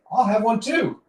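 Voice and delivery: a cartoon dog piping up for a beer; high, loud thrown voice of a dog; talking dog. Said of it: "makes the dog say".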